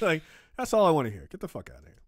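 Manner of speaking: usual speaking voice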